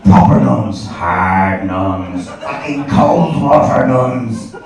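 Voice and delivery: low, drawn-out hammed-up menace; slow, heavy relish on each description; mock-tough emphasis. Comic describing the nuns who taught him, mock-menacing.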